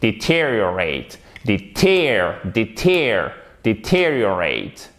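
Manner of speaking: "Deteriorate" with long falling TEER; stress on TER. Shown as a demonstration. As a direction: drill "Deter-" with punched second syllable. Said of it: "vous mettez l'accent tonique ici".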